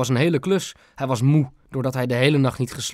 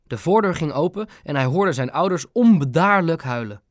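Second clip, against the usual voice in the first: usual speaking voice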